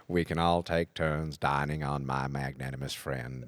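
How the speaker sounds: low, soft Morgan Freeman voice; Morgan Freeman impression. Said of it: "Mister Morgan Freeman".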